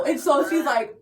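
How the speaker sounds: ordinary delivery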